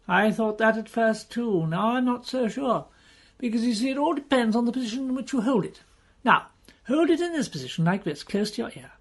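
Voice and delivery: Pew's voice. Acted. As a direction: nudge the pitch up and talk it through eagerly. Pew explains the box.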